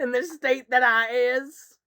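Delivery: slow put-on Southern twang; mock Southern accent. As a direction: draw it out like a cartoon Southerner. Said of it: "uneducated person from the South".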